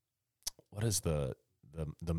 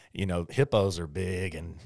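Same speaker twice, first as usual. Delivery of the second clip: ordinary delivery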